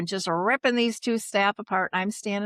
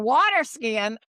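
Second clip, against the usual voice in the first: incredulous disbelief; 9 semitones higher, 4 dB louder than usual. Retold or imitated. Thinking she's hearing things.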